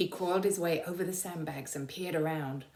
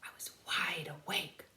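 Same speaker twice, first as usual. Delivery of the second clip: whisper; 5 dB quieter than usual. A narrator's reading. Narrator's sudden alarm.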